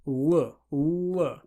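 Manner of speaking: L with the tongue between the teeth; dark L with the tongue on the teeth; Russian L. Shown as a demonstration. Teacher demonstrating the Russian L. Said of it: "put your tongue between your teeth and then say L".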